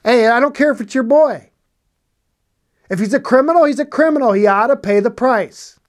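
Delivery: forceful rebuke. Real, not acted. Preacher scolding parents who shield criminal sons, loud and rising. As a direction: thunder it from the pulpit.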